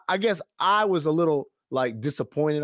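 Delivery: ordinary talk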